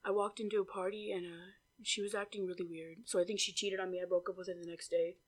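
very soft, low mumble; low, hushed mock date voice; imitated hushed talk. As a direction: imitate him mumbling his sad tale. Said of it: "like, whispering".

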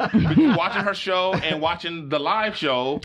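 laughing it out slowly. Real, not acted. Laughing at his wife's reaction.

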